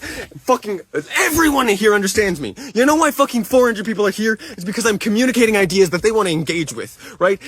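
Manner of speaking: real heated outburst with emphatic stresses; furious rebuttal. Real, not acted. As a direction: throw the blame back at them angrily.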